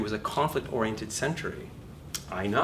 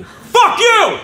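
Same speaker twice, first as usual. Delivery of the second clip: sudden hammed-up yell, much higher and louder; mock yell; 19 dB louder than usual. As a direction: bark it at the room in mock outrage. Mock shouting at laughing class.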